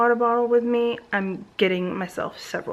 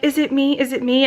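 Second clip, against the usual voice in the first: mock anxious question, pitched up; lightly voiced kids asking if it's their fault; imitating worried family; 5 semitones higher, 5 dB louder than usual.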